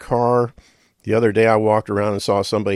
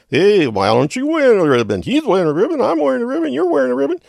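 much higher, louder put-on leader voice; march leader; 7 dB louder than usual. March leader yelling down at Kramer, much higher and louder. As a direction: ham up the march leader hollering back.

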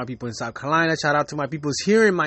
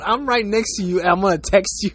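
usual speaking voice

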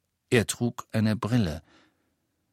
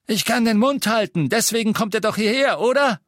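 high, loud comeback; heated retort; 13 semitones higher, 6 dB louder than usual. A narrator's reading. Innkeeper reminding Death Eaters why they come, heated retort. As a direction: fire back defiantly.

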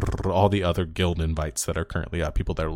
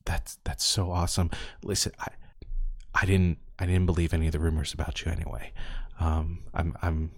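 quiet, secretive; 7 dB quieter than usual. Acted.

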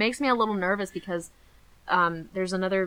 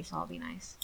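hushed teasing aside; whispered aside; 12 dB quieter than usual. Real, not acted. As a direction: whisper it under your breath.

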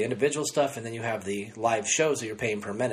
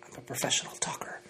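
softly whispered joke aside; 8 dB quieter than usual. Real, not acted. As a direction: drop to a whisper for the punchline. Joking aside after a self-put-down.

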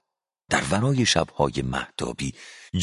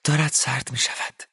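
shivering Olga; 7 semitones higher than usual. A narrator's reading. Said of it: "اولگا ایوانونا لرزید و گفت".

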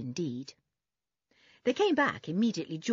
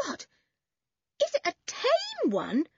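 high-pitched appalled squeak; horrified. A narrator's reading. Timid girl asks about the pet spider, horrified. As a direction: gasp it out in horrified disbelief. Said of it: "said Anne in horror".

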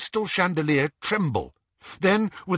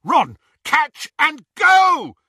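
high, loud, slowed yell; 12 semitones higher, 7 dB louder, slower than usual. A narrator's reading. Harry throwing Ron a wand, yelling. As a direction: shout it across the room. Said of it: "he yelled".

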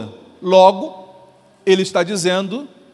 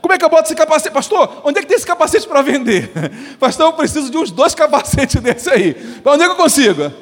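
imagined eager believer; 5 semitones higher than usual. Retold or imitated. Imagined believer asking pastor.

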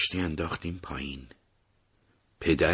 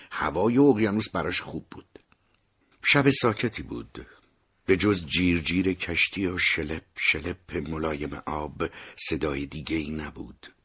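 ordinary delivery

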